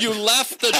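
high, mock-horrified question; freaking out. Comic imitating the producer at the audition, freaking out. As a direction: imitate her appalled disbelief. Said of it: "now she's freaking out".

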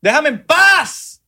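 high, loud, dragged-out plea; mock anguished wail. Host wailing at MLM recruiters to leave him alone, high and drawn out. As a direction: beg them to leave you alone, hammed up.

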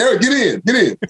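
coach calling out. Retold or imitated. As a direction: call him onto the field like a coach.

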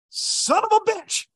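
very high, slow hammed-up curse; mock cuss. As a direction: ham up a frustrated swear. His own cursing retold.

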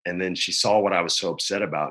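ordinary talk

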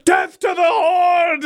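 high, slow war shout; battle cry. Acted. Butthole leading the mob to war, high battle cry.

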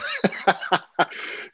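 high, airless burst of laughter; breathy laugh. Real, not acted. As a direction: laugh silently through the nose. Laughing at the story.

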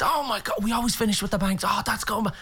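high, excited reminiscing; acted delighted recall. Singer reminisces with Dad about gigs, excited. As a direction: rush it out in a high, amazed voice.

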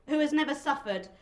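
usual speaking voice

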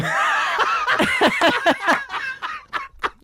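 loud squealing laugh, falling away; high burst of laughter. Real, not acted. Laughs loudly at the joke.

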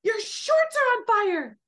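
high, slow blurted alarm; retold mid-contraction blurt, pitched high. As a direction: blurt it out in a sudden high cry. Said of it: "she blurts out".

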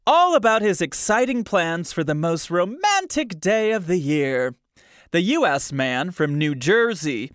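high, bright tabloid reading voice, punching key names; tabloid news read. Acted. Podcast host reading a tabloid to listeners, overdone news voice. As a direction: read the gossip story like a breathless anchor. Reads tabloid article aloud.

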